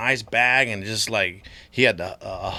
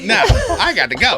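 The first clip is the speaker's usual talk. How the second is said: higher laughing no-thanks; laughing brush-off; 10 semitones higher, 7 dB louder than usual. Retold or imitated. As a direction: laugh the refusal out.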